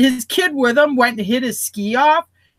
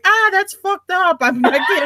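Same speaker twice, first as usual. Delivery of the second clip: mock freaked-out bystander; 9 semitones higher than usual. Woman imitating a bystander fleeing an accident, high mock alarm. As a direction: ham up a panicked onlooker. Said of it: "run away going".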